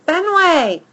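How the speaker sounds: loud call, pitched high and falling; yelling his name. A narrator's reading. Girl yelling for her dog. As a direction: shout the name across the park. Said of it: "she yells".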